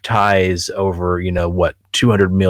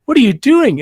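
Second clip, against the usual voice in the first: much higher, squeaky disbelief; the creep suddenly freaked out; mock horrified shriek-like question; shocked creep; 3 dB louder than usual. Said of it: "they'd be like".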